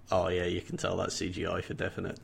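low, soft, unimpressed inner voice; underwhelmed reaction. Retold or imitated. Viewer looking at the dinosaur effects, quietly unimpressed. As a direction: say it quietly, let down. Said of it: "looking at it and going".

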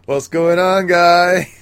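high, loud goofy greeting; silly imitated creature hailing the group; comic raptor voice. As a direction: play the raptor popping up cheerily. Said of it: "looks around like".

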